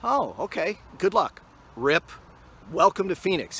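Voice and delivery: high, loud mock sarcastic trooper; mock the cop's smug send-off; trooper quote. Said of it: "He goes".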